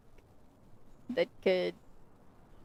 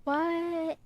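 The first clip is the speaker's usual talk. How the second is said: higher-pitched, surprised "what"; real surprise in a higher voice; 8 semitones higher than usual. Real, not acted. Player reacting to the bird reveal, surprised.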